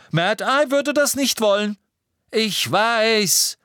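hoch und laut gebrüllt. A narrator's reading. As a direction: schrei es gequält heraus. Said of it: "brüllte Harry". (Harry brüllt die anderen an, laut und hoch)

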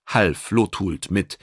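ordinary delivery

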